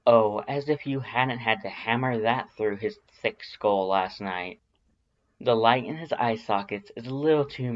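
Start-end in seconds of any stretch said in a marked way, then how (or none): none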